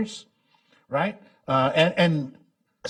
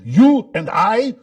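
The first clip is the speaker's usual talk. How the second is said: loud, slow, high mock Russian accent; imitating a Russian accent, loud and slow; thick Russian accent; 9 semitones higher, 11 dB louder, slower than usual. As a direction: stretch it out like an old Russian émigré. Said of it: "in his thick Russian accent".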